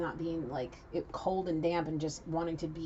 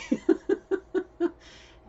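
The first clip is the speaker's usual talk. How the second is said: burst of laughter; 11 dB louder than usual. Real, not acted. Laughs at her own joke.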